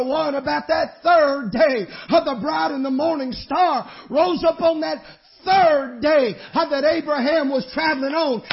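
loud, high, ringing preacher's cry; preaching at full force. Real, not acted. Preacher lifting the congregation with a loud, high cry.

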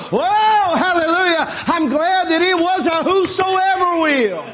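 high, exultant preacher's cry; joyful hallelujah. Real, not acted. Preacher rejoicing over his own salvation, exultant. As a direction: burst out in praise.

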